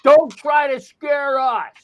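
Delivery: high, drawn-out retort, mimicking protesters; imitated angry yell. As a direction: mimic angry hecklers, stretching out the words. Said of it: "angry progressives yelling at me, saying".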